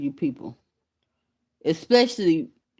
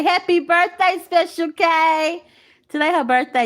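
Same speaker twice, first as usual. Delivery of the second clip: excited birthday shout; 12 semitones higher, 5 dB louder than usual. Real, not acted. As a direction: sing out the birthday wish to a friend in chat. Calling out birthday greeting to viewer.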